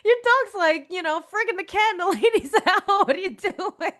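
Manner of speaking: high-pitched, laughing telling-off; mock scolding. Podcaster telling Dorothy off about her dog, playful high-pitched scolding.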